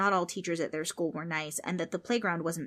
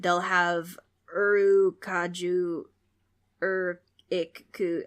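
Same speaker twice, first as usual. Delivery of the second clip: slow stumbling; 5 dB louder, much slower than usual. Acted. Ghost mangles word.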